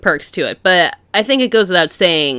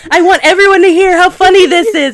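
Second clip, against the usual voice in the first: high, loud laughing protest; laughing excitement; 11 semitones higher, 8 dB louder than usual. Retold or imitated. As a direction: laugh while gushing over the joke. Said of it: "And I'm like".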